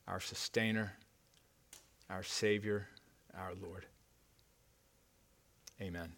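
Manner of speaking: prayer. Acted. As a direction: pray it gently before God. Pastor prays before the sermon.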